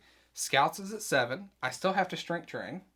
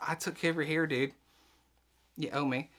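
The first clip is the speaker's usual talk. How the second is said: ordinary delivery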